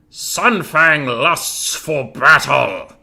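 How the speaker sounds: loud, drawn-out war cry; battle catchphrase. Acted. Game streamer trying out a warrior catchphrase for chat, booming war cry. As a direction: roar it out slowly like a battle cry. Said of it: "Which cat-, catchphrase is better".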